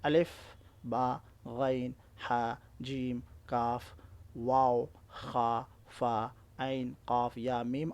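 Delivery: slow listing. Shown as a demonstration. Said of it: "حروف قمری ہیں".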